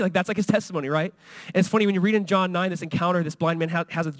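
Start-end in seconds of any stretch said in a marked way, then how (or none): none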